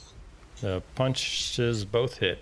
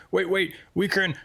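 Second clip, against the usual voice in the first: higher, hurried cry to wait; mock urgent plea; 10 semitones higher, 4 dB louder than usual. Doomed creature turning round to Weakrin, urgent mock plea. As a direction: plead in a raised mock voice. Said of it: "it's gonna turn around to you and say".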